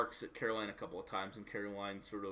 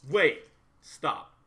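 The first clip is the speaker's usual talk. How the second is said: sudden stop; 6 semitones higher, 14 dB louder than usual. Real, not acted. GM suddenly interrupts roll.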